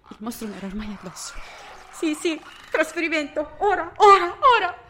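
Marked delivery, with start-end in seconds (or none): 1.9-4.8 high, very loud desperate cry; terrified screaming. Acted. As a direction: scream it as the monster grabs you. Monster on top of her.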